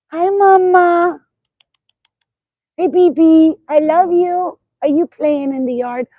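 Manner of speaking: squeaky sing-song greeting to the pets. Real, not acted. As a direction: gush at the dogs like a doting mom. Greeting her dogs.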